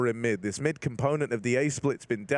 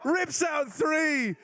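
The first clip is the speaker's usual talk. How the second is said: hyped caster yell, much higher; excited shout; 12 semitones higher, slower than usual. Real, not acted. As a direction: scream the clutch play-by-play. Clutch kill call.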